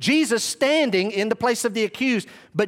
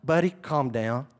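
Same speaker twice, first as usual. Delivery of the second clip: low, measured reassurance; unhurried, composed and low; calming Jesus; 6 semitones lower than usual. Acted. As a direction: settle him down in a lowered voice. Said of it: "It's as though the Lord is saying".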